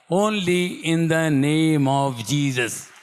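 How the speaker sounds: very slow, every word stretched out; solemn proclamation. Real, not acted. Preacher crediting his son's healing, slow solemn proclamation.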